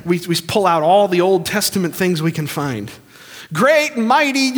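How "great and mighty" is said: high, loud pious proclamation; mock grand prayer. Preacher parodies flowery Old Testament praise, mock grand. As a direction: overblown praise, pitched way up.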